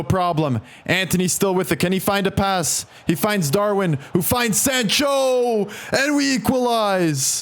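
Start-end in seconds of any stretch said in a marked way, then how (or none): 4.1-7.4 higher, slow goal call; drawn-out excited call. Real, not acted. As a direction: stretch out the equalizer with joy. Equalizing goal called.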